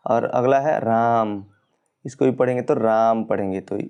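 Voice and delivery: राम with the vowel held slow and long; drawn-out राम. Shown as a demonstration. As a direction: drag out राम like calling someone. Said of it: "ये तीन गुना समय लग रहा है". (राम को लंबा खींचकर पुकारना)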